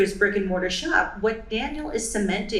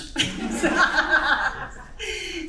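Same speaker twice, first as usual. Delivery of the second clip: embarrassed laugh; 8 semitones higher than usual. Real, not acted. Laughs at own slip.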